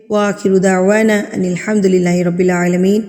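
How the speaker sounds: quick, low murmured prayer; closing dua. Real, not acted. Closing supplication. (جلدی سے اختتامی دعا)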